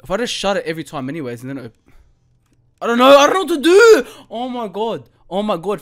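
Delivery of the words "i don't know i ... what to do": loud, high panicked cry of helplessness; panicked outburst. Real, not acted. Scared gamer yelling at the game in panic. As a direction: blurt it out in real panic.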